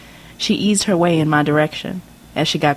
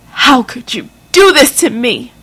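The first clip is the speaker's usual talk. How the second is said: high, loud, slow, wounded accusation; a mother betrayed, crying it out; loud tearful reproach; hurt angry mother; 7 semitones higher, 5 dB louder than usual. Acted. Mother confronts daughter over letter.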